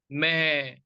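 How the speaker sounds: one word drawn out slowly; imitated halting tone. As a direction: mimic a student's stretched-out ghostly word. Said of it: "आपने जो tone पकड़ा था".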